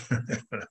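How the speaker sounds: real low, soft chuckle; excited laugh. Real, not acted. Speaker laughing because he's excited to be here, soft real laugh. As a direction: chuckle to yourself mid-sentence. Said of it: "I'm laughing 'cause I'm excited".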